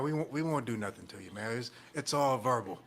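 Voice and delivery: ordinary talk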